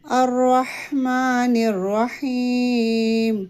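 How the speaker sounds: ما of الرحمن held one alif; slow الرحمن with drawn ما; long ma. Shown as a demonstration. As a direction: stretch the ما in الرحمن. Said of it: "ایک الف کے برابر لمبا پڑھیں گے".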